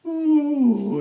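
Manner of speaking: a creepy high falsetto ooh; eerie high ooh. A narrator's reading. Creepy Santa spotting a child, high eerie coo. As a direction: coo it high and unsettling like a hungry thing. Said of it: "His voice was a high tenor with breathy overtones".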